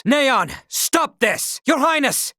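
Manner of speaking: high, slow pleading shout; desperate cry. Acted. Princess calls out to stop Neon, high pleading shout.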